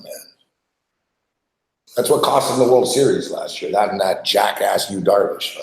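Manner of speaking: usual speaking voice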